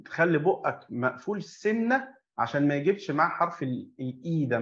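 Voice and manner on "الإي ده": ordinary talk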